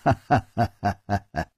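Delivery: hearty villain laugh; confident laughing. A narrator's reading. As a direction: laugh it out, fast and rolling. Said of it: "南宫灵又笑着说".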